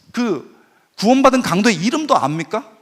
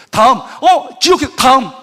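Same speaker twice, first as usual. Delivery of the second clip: hurried doctor; 5 semitones higher, 6 dB louder, slower than usual. Retold or imitated. Busy doctor dismissing patients.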